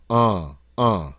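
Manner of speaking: "uh" as the vowel of bond, no N; nasal vowel. Shown as a demonstration. Teacher isolates the sound for learners, nasal vowel alone. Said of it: "That's the sound we're after".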